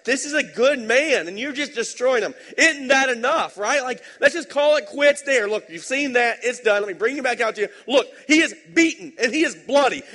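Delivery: high, loud, pleading governor; pleading Pilate. Retold or imitated. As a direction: beg the crowd as Pilate, voice up and loud. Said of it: "So he's like".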